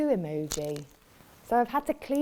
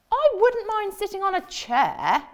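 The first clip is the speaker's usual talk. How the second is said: high, louder hopeful question; hopeful frog; 11 semitones higher, 7 dB louder than usual. Acted. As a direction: ask brightly hoping for yes. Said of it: "said the frog".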